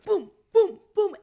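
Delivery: high, loud, punchy booms; imitated thrust booms. Helper thrusting to clear the choker's airway, forceful booms. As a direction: sound out each thrust as a separate hit.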